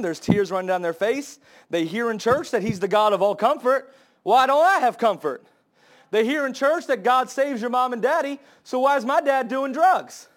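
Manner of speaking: rising sermon plea; pleading preaching. Acted. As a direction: ask it like a hurt child. Voicing a broken child.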